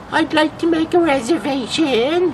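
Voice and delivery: put-on very high, quavering old lady voice; little old lady. Prank caller phoning a restaurant as a little old lady. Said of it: "little old lady voice".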